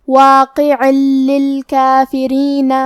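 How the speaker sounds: واقع للکافرین joined, lam doubled, slow; idgham without ghunnah. Shown as a demonstration. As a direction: merge واقع into للکافرین with no nasal hum. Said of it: "بغیر غنہ سے لیکن تشدید کے ساتھ". (واقع للکافرین کو بغیر غنہ تشدید سے ملا کر)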